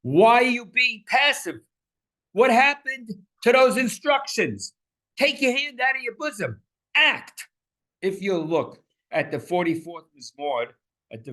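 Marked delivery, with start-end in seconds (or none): 0.0-1.6 much higher and louder, urgent; high, loud impassioned challenge; pleading demand. Shown as a demonstration. 2.3-7.5 higher and louder, insistent; high, impassioned prophet's plea; urgent demand. Shown as a demonstration.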